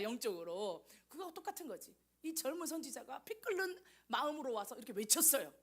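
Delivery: usual speaking voice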